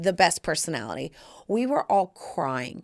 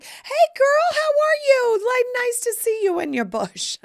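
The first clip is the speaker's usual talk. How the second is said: very high, loud squealed hello; gushing mock greeting; 5 dB louder than usual. Greeting a work acquaintance naked at the spa, gushing mock squeal.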